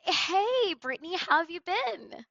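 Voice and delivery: bright friendly sales call hello, pitched high; upbeat caller greeting. Acted. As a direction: greet the client like an old friend. Sales call role play opener.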